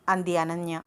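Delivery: plain reading voice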